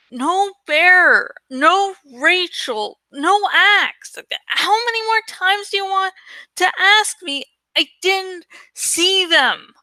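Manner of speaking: fed up with being asked, push back hard; angry. A narrator's reading. Tobias angrily refusing more questions.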